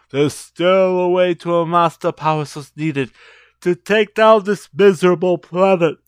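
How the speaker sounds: forceful villain master decree; Uka Uka. Acted. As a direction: cut off the excuses and proclaim your evil plan. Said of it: "great Uka Uka".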